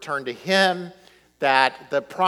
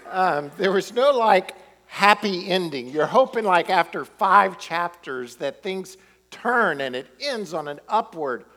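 ordinary talk

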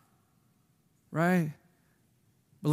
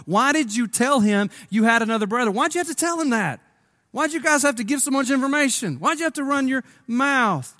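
higher, faster complaining Jacob; a light whiny old man's grumble; whining old father; 7 semitones higher than usual. Acted. Old Jacob scolding his sons for talking, whiny.

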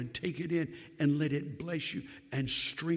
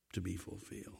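soft echo; 8 semitones lower, 14 dB quieter, faster than usual. Acted. Preacher echoing fulfilment in a hush. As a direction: still lower, near silent To be fulfilled. Pastor echoes.

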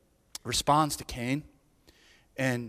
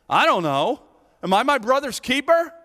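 defiant denial; 7 semitones higher, 6 dB louder than usual. Retold or imitated. Cain answering God defiantly.

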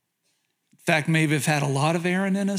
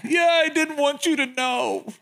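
pitched-up, put-on crying voice; imitated crying child; 8 semitones higher than usual. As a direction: imitate a tearful little girl confessing. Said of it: "she starts crying, just crying and crying".

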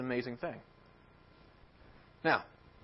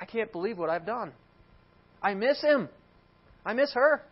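mock lament, higher and louder; imagined grief; 6 semitones higher, 4 dB louder than usual. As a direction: act out a grieving father's outcry. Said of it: "Abraham ever grieving the loss".

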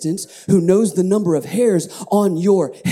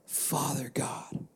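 softer, fading into a whisper; whispered thanks; 5 semitones lower, 11 dB quieter, much slower than usual. Shown as a demonstration. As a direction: breathe the thanks out quietly. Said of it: "just wanna stop right here and just say".

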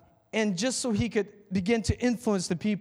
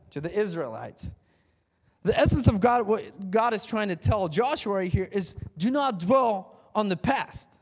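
ordinary talk, nothing marked

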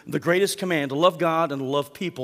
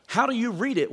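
Jesus pressing the expert, higher; Jesus turning the question back on the expert, pointed and higher; 8 semitones higher than usual. Retold or imitated. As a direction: lift the voice on each question, probing. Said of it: "He says".

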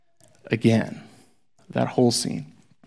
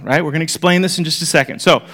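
ordinary talk

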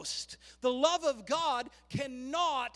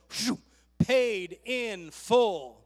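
slow, much louder, drawn out; relieved payoff; 8 dB louder, much slower than usual. Acted. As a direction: lean hard into "paid in full" like a triumphant reveal. Jesus pays with his coupon.

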